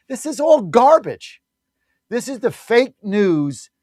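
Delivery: loud, slow, disgusted outburst; angry outburst. Real, not acted. Host ranting at the fake news, loud and slow. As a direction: spit out the word garbage with contempt.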